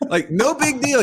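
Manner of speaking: incredulous echo. Retold or imitated. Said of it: "Ah, it's no big deal".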